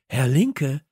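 ordinary delivery, nothing marked

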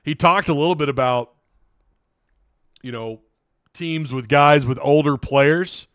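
ordinary delivery

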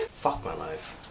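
whispered fed-up aside; whispered groan. Real, not acted. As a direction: whisper it in defeat. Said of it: "gonna take even longer to upload".

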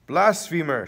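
high, loud cry of accusation; outraged shout. A narrator's reading. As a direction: shout it like an angry priest. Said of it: "shout the priests".

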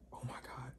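breathless unvoiced oh my God; panicked whisper. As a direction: whisper it in terror behind the door. Hiding behind the door.

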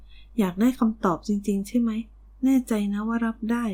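plain reading voice

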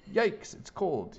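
high, drawn-out yelp; slow, high cry of mild shock. A narrator's reading. Willie dipping his foot in cold water, yelping. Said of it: "he yelled".

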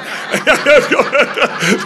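big burst of high laughter; loud high laugh. Real, not acted. Laughing hard while telling the crowd his story. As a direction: crack up loudly at the memory.

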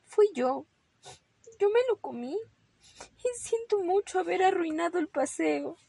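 high, sobbing little rabbit; plaintive child's weepy apology; tearful confession. Acted. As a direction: confess through acted tears. Dijo Ramón sollozando.

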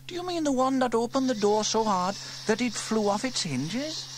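put-on high, bright-eyed girl wondering aloud; young girl Sarah, curious. A narrator's reading. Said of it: "asked Sarah".

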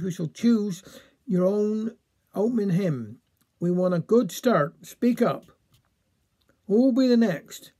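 plain reading voice

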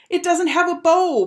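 put-on high, loud boyish protest; indignant high-pitched shout. A narrator's reading. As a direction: shout it in dismay, pitched right up. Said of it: "Bradley shouted".

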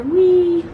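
high drawn-out "whee"; playful whee. Pretend. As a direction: squeal along with a child. Calling out playfully at passing boat.

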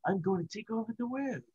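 high, soft cartoon villain baby; Stewie. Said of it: "start using a bunch of Stewie".

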